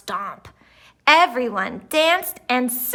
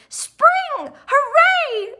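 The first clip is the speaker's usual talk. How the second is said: much higher, louder cheer; joyful shout; 4 dB louder, much slower than usual. A narrator's reading. Reporters celebrate the coming spring, shouting. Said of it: "shouted the crowd".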